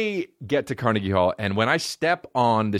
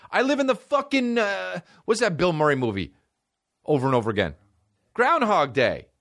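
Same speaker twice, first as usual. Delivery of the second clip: much higher, exasperated ranting; outraged rant; 11 semitones higher than usual. Retold or imitated. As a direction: vent at the venue like it's an insult. Ranting at the venue staff.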